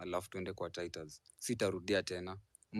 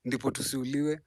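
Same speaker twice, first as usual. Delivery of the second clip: much louder and higher, fired up; loud heated outburst; 5 semitones higher, 11 dB louder than usual. Real, not acted. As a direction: shout the point across to the crowd. Host urges cursing, loud and heated.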